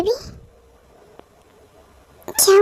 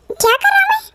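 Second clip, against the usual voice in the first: mocking playful; 11 semitones higher than usual. Boss teasing, high put-on voice.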